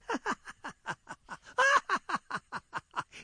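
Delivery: high, loud hyena laugh; giggling hyena cackle. Acted. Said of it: "And the hyena laughs".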